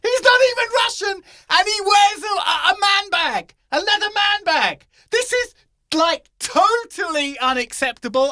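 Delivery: indignant mock fury; outraged rant. Real, not acted. Rants to his friend about a man bag, shouting high.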